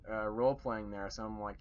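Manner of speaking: ordinary talk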